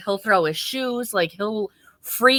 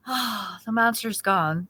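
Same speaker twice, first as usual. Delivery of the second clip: put-on relieved child; 2 semitones higher, 6 dB quieter, slower than usual.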